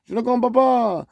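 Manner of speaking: high, hammed-up French voice pitched well up; mock French person. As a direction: play the baffled Frenchman who doesn't get it. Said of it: "And they're like".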